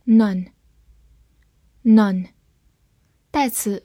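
usual speaking voice

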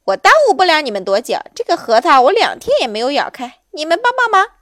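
loud, high, hurried monkey plea; pushy, excitable monkey voice; pestering monkey. Acted. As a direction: beg for help as a chattering monkey.

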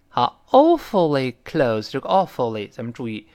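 ordinary delivery

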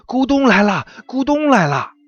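panicked echo. Acted. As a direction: pile on the panic. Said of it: "也跟着说".

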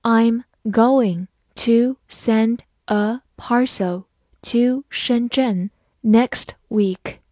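slow, careful classroom read; slow model reading for learners. Shown as a demonstration. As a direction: read each word slowly and clearly. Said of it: "好，慢读一遍".

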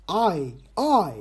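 "I" drawn out slowly as a long "ai"; English "I". Shown as a demonstration. Host sounding out a letter for listeners, slow and careful. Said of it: "那个I就是I".

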